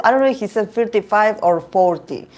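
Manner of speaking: ordinary delivery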